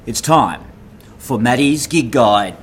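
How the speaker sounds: slow, booming show host intro; announcer voice. Acted. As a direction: announce it like a big event. Grand announcer intro of segment.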